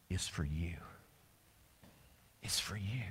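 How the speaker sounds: low, hushed, intimate whisper; preacher telling each listener personally, whispered. Real, not acted. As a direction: lean in and whisper it. Intimate appeal.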